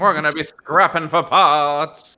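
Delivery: high, loud squeaky alien trader voice; Jawa accent. Acted. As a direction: chirp it like a little desert scavenger. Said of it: "That's my Jawa".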